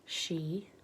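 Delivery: usual speaking voice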